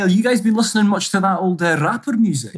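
hammed-up English accent, trilling the R; English accent, rolled R's. Guest teasing the hosts, mock English accent. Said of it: "you're rolling your R's there".

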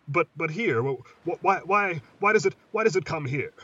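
words tumbling out in fear; panicked stammer. Acted. Terrified boy asks why monster comes.

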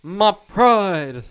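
big, high, loud mocking echo. Host parrots co-player defending their pride, mocking loudly. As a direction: throw it back as an overblown boast.

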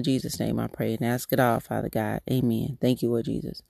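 soft, unhurried prayer voice; spoken prayer. Acted. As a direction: pray it quietly and earnestly, lifting each phrase to God. Leads a prayer.

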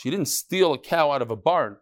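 ordinary talk

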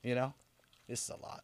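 very soft, low murmur; hushed ASMR-style voice. Real, not acted. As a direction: drop right down close to the mic. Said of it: "that's some A S M R weird stuff".

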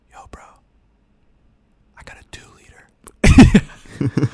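whispered, hyped bro voice; secretive teen bro whispering loud; frat bro. Retold or imitated. Kid bragging about soda, whispered.

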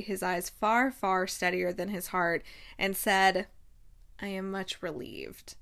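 measured, even story-reading voice; audiobook narration. A narrator's reading. Reading a romance novel aloud to listeners, measured narration. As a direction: read the novel passage evenly, with weight.

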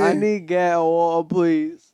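put-on loud European-accented plea; hammed-up European accent at high volume; mock European accent. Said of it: "A European accent".

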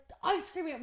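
high, loud re-enacted cry; excited mock yell. Couple spots the ice cream truck, excited mock yell. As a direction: call out in giddy, cartoonish excitement. Acting out their excited shouts.

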